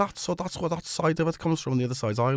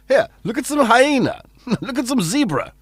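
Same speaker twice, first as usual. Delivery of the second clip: higher and louder, a hammed-up distraction; mock TV filler voice; 8 semitones higher, 12 dB louder than usual. To viewers during the technical hitch, a hammed-up wildlife distraction. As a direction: pretend to cut away to wildlife. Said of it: "Talk amongst yourselves".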